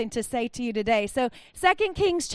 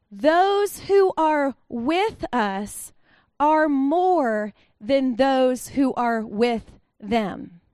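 calm prophet; much slower than usual. Acted. Prophet reassures frightened servant.

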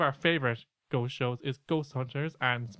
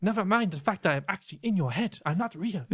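higher-pitched exasperated spirit guide; Sam; 5 semitones higher than usual. Acted. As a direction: protest in a put-on accent. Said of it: "You should do a Sam impression".

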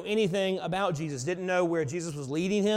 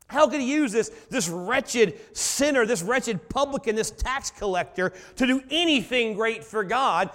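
rising preaching; 3 semitones higher, 4 dB louder than usual. Acted. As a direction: preach it with mounting force. Preacher builds to full force.

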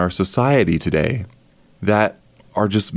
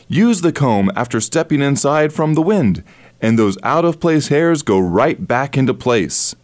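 upbeat, higher TV pitchman voice; infomercial host; 4 semitones higher than usual. Shown as a demonstration. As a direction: sell it like a late-night infomercial. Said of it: "using the infomercial style".

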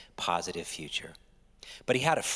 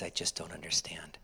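hushed confession; 3 semitones lower, 6 dB quieter than usual. Pretend. Confessing doubt, whispered.

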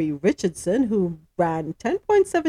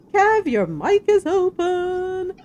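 high, drawn-out call to a colleague; 9 semitones higher, slower than usual. Real, not acted. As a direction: break off and call out slowly, up high. Calling out to colleague mid-read.

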